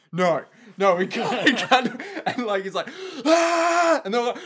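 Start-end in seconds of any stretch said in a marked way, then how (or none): 0.1-2.0 higher, laughing protest; mock panicked disbelief. Imitating football bros watching anime, mock panicked disbelief. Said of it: "all of them just go". 3.2-4.0 high, loud anime yell; imitated anime battle scream, sustained; mock power-up scream. Imitating an anime character powering up, high mock scream.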